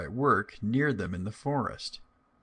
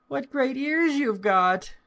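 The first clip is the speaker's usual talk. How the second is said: put-on much higher, louder little girl voice; 5 dB louder, slower than usual. Girl at grandmother's door, high child voice. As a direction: answer brightly like a small child. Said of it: "said the little girl".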